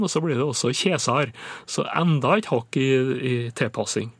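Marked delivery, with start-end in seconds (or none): 0.7-1.3 "kjesar" with a simple long e; Oppdal dialect form. Shown as a demonstration. Linguist showing Oppdal speech, long e in "kjesar". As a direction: say "kjesar" with no ei glide. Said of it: "forenklet diftongen".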